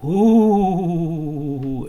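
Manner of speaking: a big drawn-out spooky ooh; ghostly ooh. A narrator's reading. Ghost calls through the big hall, long loud ooh. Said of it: "he heard the ooh again".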